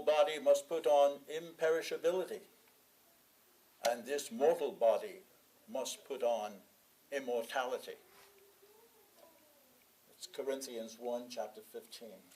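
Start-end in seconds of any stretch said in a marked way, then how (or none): none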